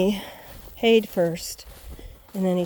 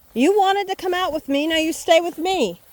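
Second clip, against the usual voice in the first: high, raised voice telling off a dog; loud scolding; 9 semitones higher, 6 dB louder than usual. Real, not acted. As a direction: scold the dog loudly to keep it close. Scolding dog to stay close.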